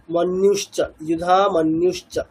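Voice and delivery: মন্যুশ্চ with ন্য gliding into উ, যুধা lifted, slow; slow recitation demo. Shown as a demonstration. Teacher reciting the verse phrase slowly for students. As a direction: chant যুধা মন্যুশ্চ carefully. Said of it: "এরকম আরো আছে।".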